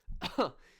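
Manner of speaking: a short, loud laugh bursting out at his own joke; real burst of laughter. Real, not acted. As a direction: laugh out loud, high and loud, amused.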